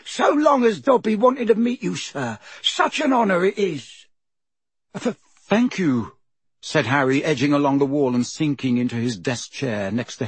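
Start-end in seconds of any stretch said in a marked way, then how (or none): 0.0-4.1 squeaky, reverent little elf; high-pitched creature. A narrator's reading. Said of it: "in a high-pitched voice". 5.0-6.1 slow, hesitant, higher-pitched thanks; nervous stammer. A narrator's reading. Nervous Harry answers the creature.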